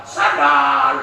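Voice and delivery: loud curt order; demanding shout. Acted. Husband orders wife for sandals, loud curt shout.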